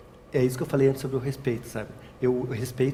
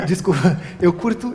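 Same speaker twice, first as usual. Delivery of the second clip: higher and louder, breaking out of a laugh; laughing apology; 4 semitones higher, 6 dB louder than usual. Real, not acted. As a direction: apologise through the tail of a laugh. Laughs at own swearing, apologises. (pedindo desculpa entre risos)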